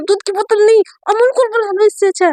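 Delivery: offended objection, pitch up; annoyed protest. Acted. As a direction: push back hard at the silly nickname. Mayra objects to being called bottle.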